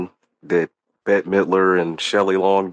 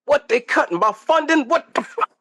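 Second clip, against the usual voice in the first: high, mock-indignant voice; mock outrage. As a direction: squeal it like a wronged character.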